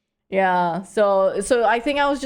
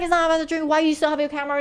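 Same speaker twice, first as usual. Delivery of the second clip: high-pitched imitation of his complaint; mock angry man; 8 semitones higher, much faster than usual. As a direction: mimic an angry drunk's rant, pitched up. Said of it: "he started getting really angry".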